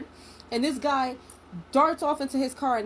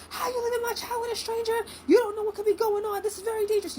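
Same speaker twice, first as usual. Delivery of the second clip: furious mom scolding; 12 semitones higher than usual. Retold or imitated. Mother on FaceTime tearing into the father, high furious scolding. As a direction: let him have it, voice pitched way up. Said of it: "letting him have it".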